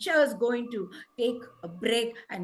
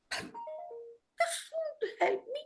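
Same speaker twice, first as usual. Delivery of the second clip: high, slow, softer wail; drawn-out, tearful-sounding whine; whining dismay; 12 semitones higher, 7 dB quieter, much slower than usual. Acted. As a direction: whimper in mock despair. Crow despairs at stick's refusal.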